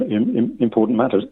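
ordinary talk

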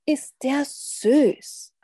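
high, drawn-out gushing; doting exclamation. A narrator's reading. Grandparents admire their biting baby vampire, gushing. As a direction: coo over an adorable baby, stretching it out.

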